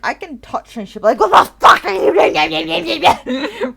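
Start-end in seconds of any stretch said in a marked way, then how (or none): 1.2-3.7 slow, loud, higher indignant dog voice, drawn out; grumpy dog voice. Retold or imitated. Owner voicing her grumpy dog being touched, slow and loud. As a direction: play the offended dog snapping back at its owner.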